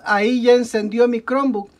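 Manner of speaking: ordinary talk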